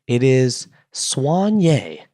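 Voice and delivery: soigné said swahn-YAY, slow and careful, French nasal vowel; French pronunciation. Shown as a demonstration. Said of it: "how do you pronounce it".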